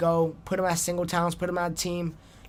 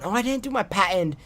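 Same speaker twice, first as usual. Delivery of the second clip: ordinary talk, nothing marked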